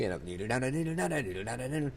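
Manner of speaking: sung boogie-woogie piano riff; rolling nonsense-syllable piano imitation; imitated boogie piano. Shown as a demonstration. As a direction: vocalise a rolling bluesy piano lick. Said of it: "boogie, bluesy type sound".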